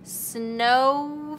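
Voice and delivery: Snow stretched with a rise; stretching Snow for the class; sounding out slowly. Shown as a demonstration. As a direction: draw out Snow. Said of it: "say it really slowly".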